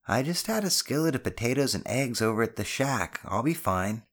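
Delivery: put-on boy insisting he is fine; reassuring son. Voices the son.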